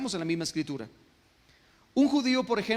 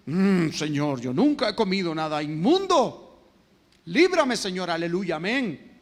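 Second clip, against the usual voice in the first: indignant pious Peter; 5 dB louder than usual. Acted. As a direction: ham up Peter's pious refusal to God. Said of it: "Y Pedro". (Pedro escandalizado, exagerado y fuerte)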